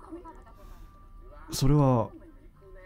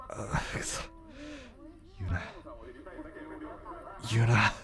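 choked, breaking curse, then softer to himself; holding back tears; 13 dB quieter than usual. Acted. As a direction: fight the tears and tell yourself not to say it. Brother hiding feelings at her news.

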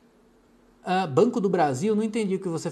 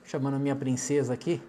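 quiet aside; 8 semitones lower, 9 dB quieter than usual. Real, not acted. Asks wife to button coat.

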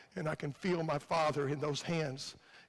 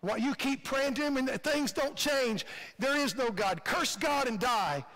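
preacher lightly voicing the devil's taunts, higher; the devil's lies; 6 semitones higher than usual. Devil taunting a believer who keeps praying, insistent. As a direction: press each accusation home, pitched up. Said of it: "He says God doesn't care about you".

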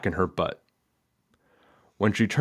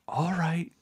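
soft, high, hushed reply; whispered; 8 semitones higher, 9 dB quieter than usual. A narrator's reading. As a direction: say it under your breath. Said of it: "Ryan whispered".

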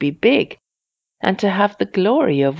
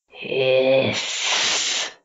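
long drawn-out hiss; angry snarl. A narrator's reading. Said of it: "An angry snarl came".

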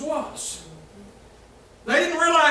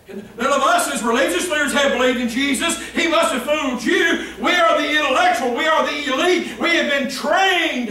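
louder, higher haughty boast; religious leaders scoffing at the guards, smug and loud; arrogant mock elite; 5 semitones higher, 5 dB louder than usual. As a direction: lord it over the guards. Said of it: "They were talking down to the guards".

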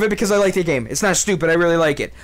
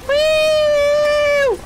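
long, very high-pitched "woo"; high falsetto whoop; excited whoop. Real, not acted. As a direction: squeal out a sustained whoop. Whooping during play.

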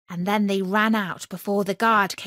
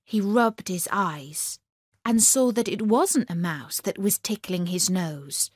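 ordinary delivery

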